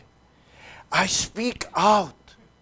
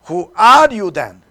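call and response; 11 dB louder than usual. Shown as a demonstration. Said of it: "let's say together".

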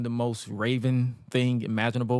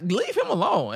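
high mock yell; Francine; 7 semitones higher than usual. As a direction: protest in a raised pitch. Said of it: "after all that yelling".

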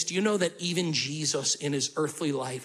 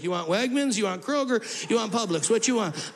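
higher, half-sung offer of grocery stores; playful sing-song; 5 semitones higher than usual. Pretend. Joking Jesus offers his mother grocery stores, sing-song. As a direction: tease like a cheeky son listing shops. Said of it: "And he'd be like".

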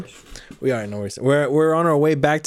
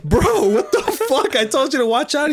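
laughing outrage; 12 semitones higher than usual. Retold or imitated. Scolding the lookout friend, high-pitched laughing. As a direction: squeal it through laughter.

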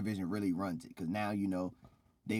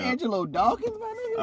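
much higher, louder cheer for a favorite; excited shout; 8 dB louder than usual. Real, not acted. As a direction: hype up your favorite wrestler. Defending favorite wrestler.

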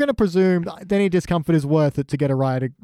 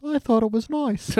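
timid mock protest; 9 semitones higher than usual. Singer answering the grumbling men, high mock protest. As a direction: pitch it up high for a small objection.

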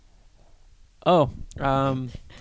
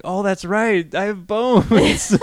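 mock surprise; 8 semitones higher, 9 dB louder, much slower than usual. Mock realization retold.

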